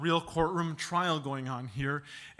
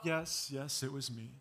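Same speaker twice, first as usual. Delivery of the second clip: soft, sheepish yes, much softer; child admitting; 3 semitones lower, 7 dB quieter than usual. Retold or imitated. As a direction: mumble the confession like a caught child. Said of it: "the child to say".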